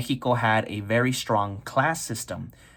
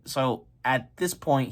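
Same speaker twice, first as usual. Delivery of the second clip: ordinary delivery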